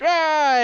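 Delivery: high fan squeal, imitated; short, high imitated cheer; crowd cheer. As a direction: mimic an excited fan's cry. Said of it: "And you hear, like".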